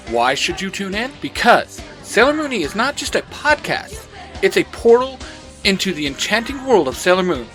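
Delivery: plain reading voice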